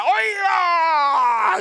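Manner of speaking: high, loud barracks shout; barked command. Retold or imitated. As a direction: yell it down the dormitory. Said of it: "Y decía". (grito de mando en el dormitorio)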